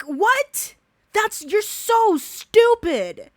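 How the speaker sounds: high, loud furious outburst; real outrage, voice climbing high and loud; angry yelling. Real, not acted. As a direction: yell at him in disbelief. Furious confronting a predator.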